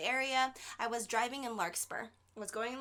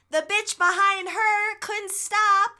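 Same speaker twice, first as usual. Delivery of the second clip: excited; 9 semitones higher, 12 dB louder, slower than usual. Real, not acted. Climax of crash story.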